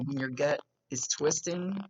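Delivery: ordinary delivery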